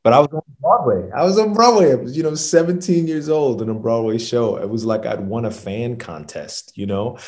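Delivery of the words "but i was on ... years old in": excited. Real, not acted. Thrilled about reaching Broadway.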